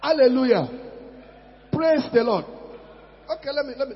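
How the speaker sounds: ordinary talk, nothing marked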